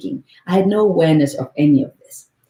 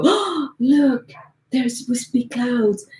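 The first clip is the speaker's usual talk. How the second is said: higher, light, lightly voiced wonder; mock delighted sky; 7 semitones higher, slower than usual. The sky pretending to admire wispy clouds, light mock delight. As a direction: coo over the clouds in play. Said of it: "The sky doesn't go".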